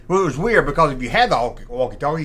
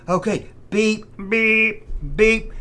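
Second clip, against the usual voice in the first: imitated Morse beeps; 7 semitones higher, slower than usual. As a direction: chirp each beep like a radio tone.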